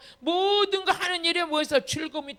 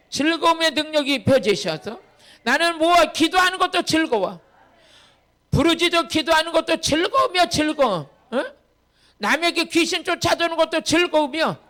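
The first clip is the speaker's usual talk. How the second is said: loud, emphatic pulpit delivery; full-force preaching; 3 semitones higher, 7 dB louder than usual. Real, not acted. Preacher telling the congregation that prayer is joy, fervent preaching. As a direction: drive each phrase home with force.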